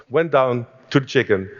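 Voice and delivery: usual speaking voice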